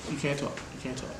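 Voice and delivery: hushed aside. Real, not acted. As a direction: hush it off to the side, away from the mic.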